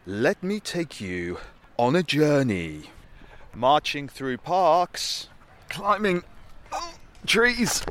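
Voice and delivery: big booming trailer narrator. Pretend. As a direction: announce it like an epic documentary. Mock grand trailer presenter.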